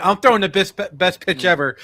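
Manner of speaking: loud, high mock-proud boast; cocky pitcher sure of his best pitch. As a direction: puff up like you just threw a gem. Acted proud pitcher thought.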